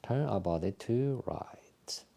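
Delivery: soft, calm breathing cues with whispered exhales; guided exercise instructions. Acted. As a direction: talk the class gently through each movement. Leads a qigong breathing routine.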